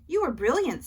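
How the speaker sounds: high, loud proud praise; excited praise. A narrator's reading. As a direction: beam with pride. Dad praising daughter after show.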